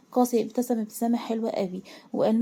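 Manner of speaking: ordinary delivery, nothing marked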